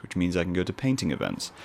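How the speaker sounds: usual speaking voice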